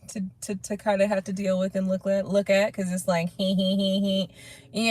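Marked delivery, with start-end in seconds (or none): 3.4-4.9 quiet, sly little snicker; sneaky little laugh. Retold or imitated. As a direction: snicker under your breath like you're getting away with it. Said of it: "You get the little sneaky little laugh".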